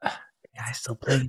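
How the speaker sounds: whispering to himself. Real, not acted. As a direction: breathe the words, unvoiced.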